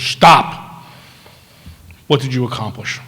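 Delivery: sharp loud "Stop!" then a firm demand; stern nun's shout. Retold or imitated. Nun stopping the young doctor mid-excuse, loud and stern. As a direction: cut him off like a furious nun.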